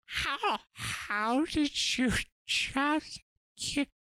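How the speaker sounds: high, slow, awestruck monkey voice; a monkey just waking up to speech; smart monkey. Acted. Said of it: "you do the monkey".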